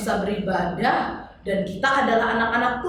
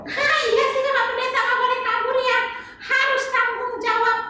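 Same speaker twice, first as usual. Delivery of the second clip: put-on high, sing-song little girl voice; chirpy kid puppet answering the pastor; high child puppet voice; 13 semitones higher than usual. Tiur agreeing with pastor.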